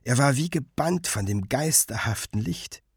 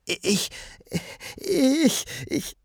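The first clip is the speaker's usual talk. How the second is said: stammelnd; 13 semitones higher, much slower than usual. A narrator's reading. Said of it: "verzweifelt suchte er nach Worten".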